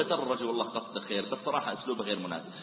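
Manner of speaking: calm him with quiet agreement; soft reassurance. Shown as a demonstration. Preacher showing what to tell the angry man, softly reassuring. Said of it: "ثم تقول له".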